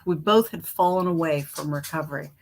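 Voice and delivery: usual speaking voice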